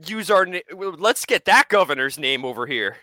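mock state officials. Mocking Delaware founders picking a name, loud and silly. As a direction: ham up the desperate officials. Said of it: "They're like".

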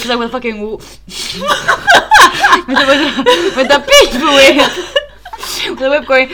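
high, loud, words broken by laughter; can barely get it out for laughing; laughing hard. Real, not acted. Laughing about being whipped.

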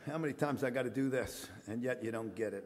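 a gentle, weary heavenly sigh of come on; a hint of Jesus despairing of Anthony Parisi; exasperated Jesus. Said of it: "from heaven, be going".

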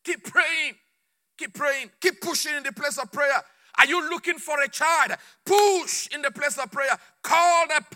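high, forceful chanting-like commands; impassioned exhortation. Real, not acted. Preacher urging listeners to push in prayer, impassioned. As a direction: urge them on, punching each command.

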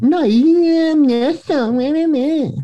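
high, far-off mocking voice; mock your friend in a high voice; Nestle voice. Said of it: "It's my Nestle voice".

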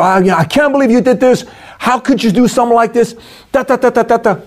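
high-pitched accusing outburst; shrill mock scolding, trailing off into da da da; accusing rant. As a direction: blame the person, voice up high. Bad example: hard on person.

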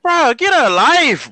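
loud, slow drawn-out get a life; exasperated yell. Real, not acted. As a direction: call it out slow and fed up. Yelling at the TV character.

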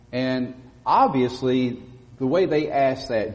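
usual speaking voice

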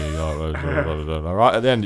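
ordinary talk, nothing marked

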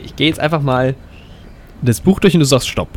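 usual speaking voice

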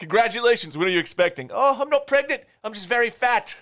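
high, lively voiced exchange between two characters; quoting two movie characters. Friend quoting the pregnant-or-fat movie gag. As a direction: act out both sides of the joke. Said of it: "quoting movie lines".

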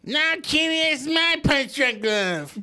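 high, whiny cartoon kid voice; Cartman voice. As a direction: whine it like a bratty cartoon boy. Cartman voice bit, scolding cat.

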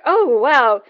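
high, loud mock wow; impressed amazement. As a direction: act out a gushing, impressed reaction. Imagined admiring reaction of strangers.

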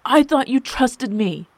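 loud, high, wounded outburst; slow, forceful cry of hurt; hurt exclamation. A narrator's reading. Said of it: "Seb exclaimed, equally hurt".

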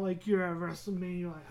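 normal talking voice

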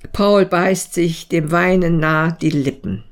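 plain reading voice

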